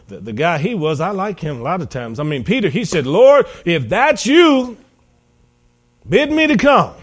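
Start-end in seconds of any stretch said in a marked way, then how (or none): 3.0-6.9 high, loud, slow dramatic call. Acted. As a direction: call across the water to someone far off. Peter voiced calling to Jesus from boat.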